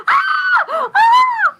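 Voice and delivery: two high, loud screams; piercing imitated screams with gulped breaths; hysterical scream. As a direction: shriek "Ah!" like a child in hysterics. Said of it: "screaming in hysterics".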